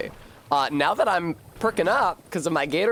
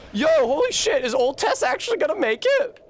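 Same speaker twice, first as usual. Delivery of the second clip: much higher, louder, thrilled; excited disbelief; 13 semitones higher, 3 dB louder than usual. Real, not acted. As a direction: blurt it out amazed. Excited surprise at surviving.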